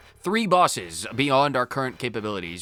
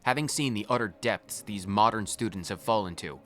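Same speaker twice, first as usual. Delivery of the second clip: softer, grave lore reading; lore narration; 3 semitones lower, 5 dB quieter than usual. Acted. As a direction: read the boss description like a chronicle. Reads boss lore aloud.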